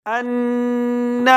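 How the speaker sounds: ان with a long nasal hum on ن; nasal ghunna. Shown as a demonstration. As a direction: hum the ن through the nose. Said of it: "نون پر غنہ کریں گے".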